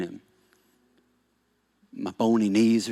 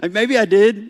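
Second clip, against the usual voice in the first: mock nervous inner voice; 10 semitones higher, 5 dB louder than usual. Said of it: "you're like".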